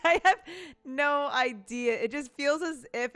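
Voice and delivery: laughing through the words; amused, laughing it out. Real, not acted.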